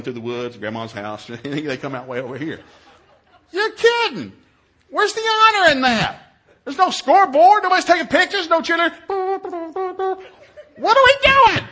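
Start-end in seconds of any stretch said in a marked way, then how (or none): 3.5-11.6 high, loud mock outrage; incredulous rant. Dad with nothing to watch at the race, incredulous. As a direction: wail each complaint in comic disbelief.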